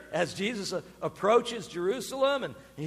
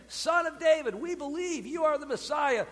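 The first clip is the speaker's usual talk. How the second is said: blind men pleading; 7 semitones higher than usual. Retold or imitated. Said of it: "so they were saying".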